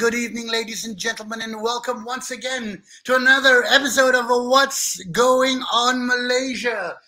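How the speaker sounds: ringing programme intro; show host opening. Real, not acted. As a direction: announce the show with long held words.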